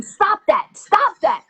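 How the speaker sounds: high-pitched, irritated retold command; agitated order. Robber to woman measuring his height, agitated. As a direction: play the exasperated robber.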